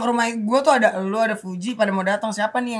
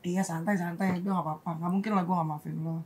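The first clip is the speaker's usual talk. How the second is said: hushed, tender retold reassurance; gentle reassurance; 6 semitones lower, 11 dB quieter than usual. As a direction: imitate low, soft forgiveness. Re-enacts gentle forgiveness.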